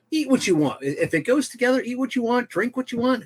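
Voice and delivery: high dismissive voice; mock skeptic. Friend voicing someone scoffing at pairing rules, high.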